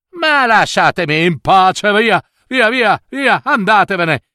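high, irritated shooing; rude dismissal. Acted. Spoilt Cecilia sending the fairy away, irritated. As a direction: wave off a nuisance impatiently.

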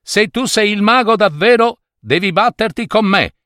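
high-pitched challenge; defiant young hero. A narrator's reading. Young man challenges the wizard to fight, defiant. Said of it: "disse il giovane".

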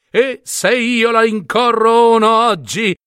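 a feather duster's crafty aside; scheming thought. Acted.

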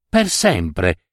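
plain reading voice

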